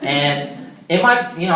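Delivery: ordinary talk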